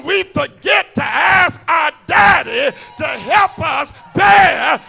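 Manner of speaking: rising, shouting preacher cadence; preaching at full force. Acted. Preacher pressing the congregation, full-force shout. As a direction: drive the question home to the church.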